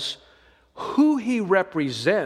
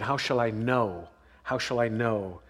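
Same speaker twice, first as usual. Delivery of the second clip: low, soft, searching repetition; pondering under the breath; quiet musing; 7 semitones lower, 5 dB quieter than usual. Shown as a demonstration. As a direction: turn the question inward, much softer. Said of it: "let me help you with that question".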